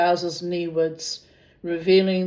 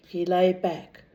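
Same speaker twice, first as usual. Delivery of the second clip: usual speaking voice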